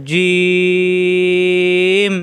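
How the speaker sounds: জিম with a very long, level ই; elongated vowel. Shown as a demonstration. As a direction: hold the vowel of জিম before closing on the m. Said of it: "লম্বা করা যাচ্ছে". (অনেক লম্বা টানে জিম)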